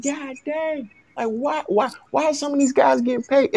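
high-pitched, incredulous mock exclamation; retold disbelief. Fan marvelling at coaches getting paid, in high-pitched disbelief.

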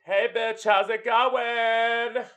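very high, drawn-out sassy hello. Retold or imitated. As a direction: sing out the greeting to your best girlfriend.

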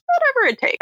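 squeaky high vow; mock earnest cop. Host mocks the detective's promise, pitched high. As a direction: overplay the promise. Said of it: "McDreamy is just like".

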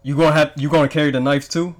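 normal talking voice

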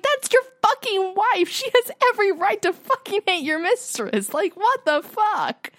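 high-pitched, incredulous indignation; outraged outburst. Acted. Viewer telling Charles off over his wife, outraged. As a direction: scold him in a high, heated voice.

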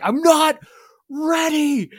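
high strained shout; a high mock yell of not ready; retold yell. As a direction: yell it like you're panicking on stage. Drummer yells he's not ready.

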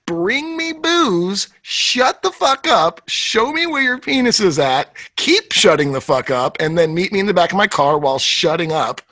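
slow mock paraphrase. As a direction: drag the first words out. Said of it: "She's pretty much saying".

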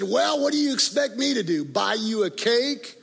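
mocking friend.

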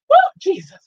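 a sudden yelp of surprise; startled whoop. Real, not acted. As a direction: jump at something unexpected. Sudden outburst mid shout-outs.

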